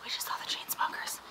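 hushed hotel-room whisper. Real, not acted. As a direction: breathe it out as a whisper. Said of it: "We have to be quiet".

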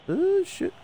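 sudden high cry of dismay; shocked yelp. Real, not acted. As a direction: voice shoots way up in shock. Chance goes wrong.

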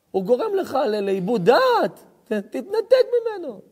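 high, loud, slow preacher's call; forceful preaching. Acted. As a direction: declaim each line like a sermon refrain. Opening chant-like preaching. (דרשה נלהבת בקול רם וגבוה)

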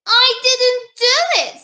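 put-on high-pitched protesting child voice; storybook princess, pitched well up; little princess. A narrator's reading. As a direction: protest innocence like a little girl. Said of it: "said the little princess".

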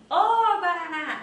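mock street hail, front-loaded; wrong-way call. Shown as a demonstration. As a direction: hail someone with a loud, high "ô" before GUARANÁ. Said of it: "Eu não vou falar assim".

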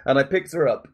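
usual speaking voice

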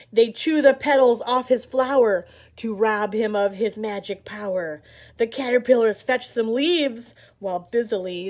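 put-on lively read-aloud for little kids; animated picture-book reading; story narration. A narrator's reading. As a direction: read it like story time on the rug. Reads picture book to children.

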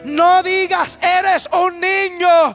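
slow, stretched-out declamation; sustained, solemn preacher's delivery; proclaimed scripture. Retold or imitated. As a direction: proclaim it slowly as God's answer, phrase by phrase. Proclaims God's answer from scripture.

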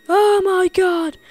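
loud high startled exclamation; startled cry. Pretend. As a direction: blurt it out in fright. Startled by a cave.

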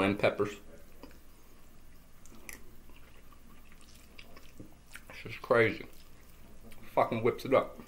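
speaking between bites; slightly chewed words; talking while eating. Real, not acted. Seen 0: Forks food from plate throughout, talking between bites, possibly chewing.

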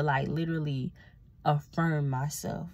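ordinary talk

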